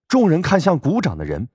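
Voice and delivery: ordinary delivery, nothing marked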